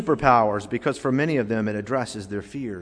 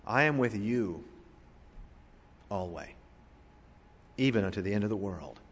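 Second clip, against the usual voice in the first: hushed, pausing after "you" and isolating "always"; stress by pauses; 3 semitones lower, 9 dB quieter than usual. Shown as a demonstration. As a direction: let "you" hang, then drop low on "always". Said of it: "Emphasize a different word".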